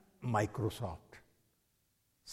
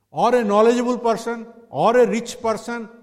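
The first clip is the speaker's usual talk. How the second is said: raised preaching voice; loud emphasis; 10 semitones higher, 12 dB louder than usual. Real, not acted. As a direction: call it out loud and high.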